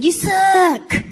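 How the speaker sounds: put-on high-pitched kid's heckle, drawn out; retold yell. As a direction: yell it like a small boy at a wrestler. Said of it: "so I yelled at him".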